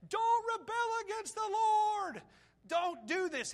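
high, loud, desperate cry; urgent plea. Retold or imitated. Joshua and Caleb crying out to the people, high and loud. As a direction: beg them not to rebel, slowly at first. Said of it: "they begin to say".

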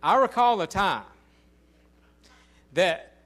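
loud, ringing pulpit voice; full-force preaching. Acted. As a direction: preach it out to the back pew.

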